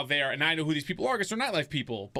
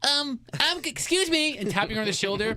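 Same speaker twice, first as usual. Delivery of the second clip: very high, loud, hammed-up timid interruption; mock whiny wimp; 11 semitones higher, 5 dB louder than usual. Man imagining himself interrupting his date, mock whiny wimp. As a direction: play a pathetic little tag-along begging for notice. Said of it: "like a little bitch and be like".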